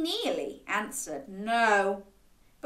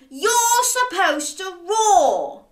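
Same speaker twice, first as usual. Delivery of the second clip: loud, high, slow protest; indignant challenge; 8 semitones higher, 7 dB louder than usual. A narrator's reading. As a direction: tell off a dragon in a raised voice. Boy challenges the silent dragon.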